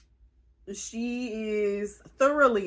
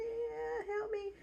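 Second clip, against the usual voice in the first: mock tiny voice; 14 semitones higher, 12 dB quieter, slower than usual. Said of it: "He's like".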